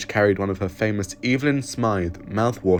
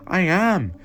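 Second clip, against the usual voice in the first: high, loud reply; shouting; 11 semitones higher, 6 dB louder than usual. Acted. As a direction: shout back over the chaos. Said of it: "Evelyn shouted".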